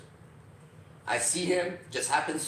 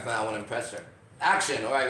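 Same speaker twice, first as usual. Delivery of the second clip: ordinary delivery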